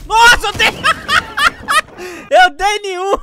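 loud and laughing in disbelief; laughing shout. Real, not acted. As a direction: crack up at your own miss. Missed shots, laughing at himself.

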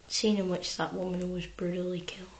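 low, hushed whisper; signing off on the gory story in a solemn hush; mock-solemn whisper. Real, not acted. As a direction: whisper like at a funeral. Solemn aside after story.

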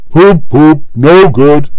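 loud, sulky horn-like grumble; grumbling Gordon. A narrator's reading. Gordon at the hill bottom, grumbling loudly. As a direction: sound the poop sourly. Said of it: "grumbled Gordon".

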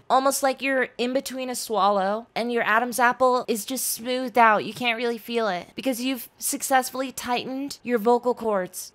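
high, put-on girl voice while explaining; girl voice demo. Shown as a demonstration. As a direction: speak in a constricted high girl voice. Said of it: "Oftentimes when I'm doing it".

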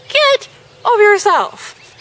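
exasperated at the reader; exasperated high. Shown as a demonstration. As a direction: throw it up in disbelief.

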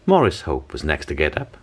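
ordinary delivery

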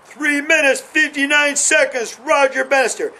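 high, ringing announcer call of the time; race announcer. Retold or imitated. Runner pictures the announcer calling his time, proclaimed high. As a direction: proclaim the record time like the stadium speaker. Visualizing the record again.

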